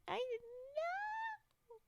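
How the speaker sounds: high, slow, squeaky mock of chat. Teasing chat who missed the notice, slow and high. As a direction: drag it out high to tease chat. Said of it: "You were like".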